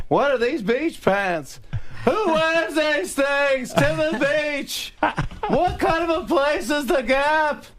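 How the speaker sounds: very high, slow, stretched exclamations; Jerry Seinfeld yelling. Retold or imitated. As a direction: yell each question in a high, incredulous pitch. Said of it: "So we're just yelling back and forth".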